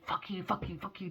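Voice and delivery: put-on hushed, secretive childish swearing; whispered swearing. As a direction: sneak the swear words out under your breath. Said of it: "We say".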